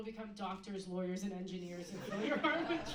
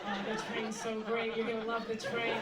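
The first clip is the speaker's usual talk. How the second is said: louder, slow mock rapture about the train; train enthusiast; 6 dB louder than usual. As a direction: gush over the train like it's Paris. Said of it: "all you ever say".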